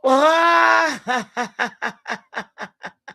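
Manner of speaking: big loud laugh that falls away; real shouted laugh; loud burst of laughter. Real, not acted. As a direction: let the laugh burst out, then drop. Laughing after the hyped reaction.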